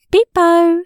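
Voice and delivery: high, loud sing-out; playful peekaboo call. A narrator's reading. Narrator plays peekaboo with the baby, playful high call.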